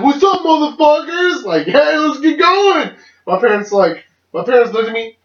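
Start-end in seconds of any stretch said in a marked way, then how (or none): 0.0-2.9 put-on high, whooping drunken entrance; rowdy, high-pitched imitation of a drunk self; drunken party holler. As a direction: burst in hollering like the party just arrived. Drunk self bursting in on parents.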